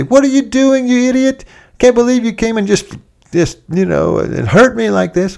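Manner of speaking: high, loud, indignant yelling at a friend; angry outburst. Acted. Wounded man yelling at the friend who touched him, angry. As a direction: snap at him like the wound hurts.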